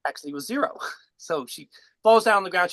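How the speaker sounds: ordinary talk, nothing marked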